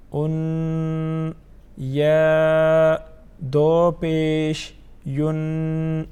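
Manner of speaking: drawn-out monotone letter chanting; measured teacher's recitation, syllables sustained; Qaida recitation drill. Shown as a demonstration. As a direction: hold each letter long on one even note.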